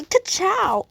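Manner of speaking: ka-chow as a bright distraction; playful catchphrase. Pretend. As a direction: fling the catchphrase out to move on. Catchphrase to change the subject.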